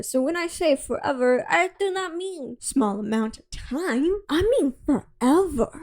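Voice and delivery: over-earnest, loudly proclaimed devotion; mock gushing declaration. Edward gushing about the engagement, acted.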